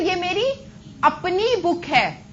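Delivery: slow down and lift अपनी for emphasis; stress on अपनी. Shown as a demonstration. Teacher contrasting with the simple sentence, stress on अपनी. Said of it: "जोर डाल के बोला".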